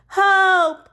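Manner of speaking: high, loud panicked shout; cry for help. Acted. Boy alone in dory among whales, panicked shout for help. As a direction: call out in mock terror.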